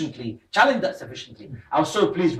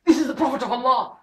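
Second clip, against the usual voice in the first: high-pitched mock shout of horror; raised, high voice, acting out a shocked scream; retold scream; 10 semitones higher than usual. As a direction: cry out aghast, as the scandalised father. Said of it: "The father screamed".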